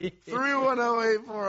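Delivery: slow, laughing delivery. Real, not acted. Host laughing as he repeats the call-in number. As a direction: drag it out while laughing.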